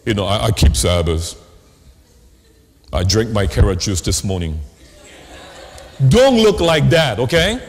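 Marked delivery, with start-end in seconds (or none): 0.3-4.6 low, dour, sour-lemon voice; sour churchgoer. Acted. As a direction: imitate a joyless, sour believer, pitched low. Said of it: "those sour lemon S D A people".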